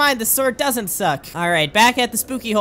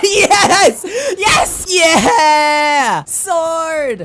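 very high, loud shouts of triumph; victory cheer; 6 semitones higher, 6 dB louder, much slower than usual. Real, not acted. Gamer finally beating the tough enemy, loud cheering. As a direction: cheer the kill. Wins the fight.

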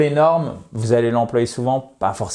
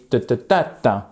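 "ta" syllables mimicking a word's stress pattern; rhythm demo; 2 semitones lower than usual. Shown as a demonstration. Teacher beats out the rhythm for learners, rhythmic syllables. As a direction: tap out "Ta, ta, ta, ta" like beats. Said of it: "il y a un rythme".

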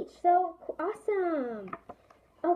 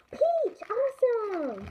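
excited; 8 semitones higher, 4 dB louder, slower than usual. Real, not acted. Opening a blind-bag toy.

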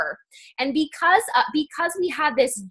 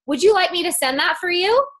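direct ask; 7 semitones higher than usual. Shown as a demonstration. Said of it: "see how awkward and silent it is".

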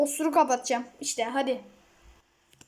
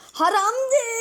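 mock high voice; 6 semitones higher than usual. Shown as a demonstration. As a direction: repeat Haramdır as a funny preachy character. Repeats the word in another voice. (Haramdır yüksek ve yapmacık sesle)

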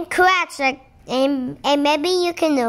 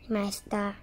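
normal talking voice

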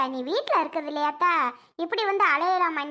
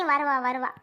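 ordinary delivery

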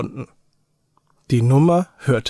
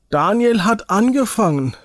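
put-on a boy crying out in outrage, pitched up; indignant cry; 7 semitones higher than usual. A narrator's reading. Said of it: "rief Robert empört".

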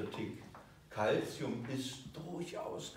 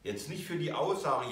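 ordinary talk, nothing marked

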